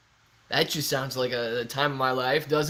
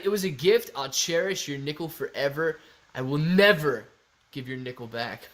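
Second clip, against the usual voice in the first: ordinary talk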